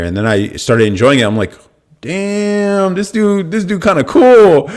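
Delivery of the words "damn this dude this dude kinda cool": much higher, slow impressed drawl. Retold or imitated. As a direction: savor the realization, slowly. Warming up to Trump.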